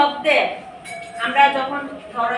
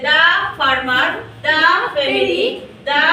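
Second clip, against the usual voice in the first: the farmer, the family read very slowly, each "the" as দা; slow model reading; much slower than usual. Shown as a demonstration. Teacher models "the" as দা for pupils, slow and deliberate. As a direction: spell it out slowly for the class. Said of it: "কখন হবে দা?".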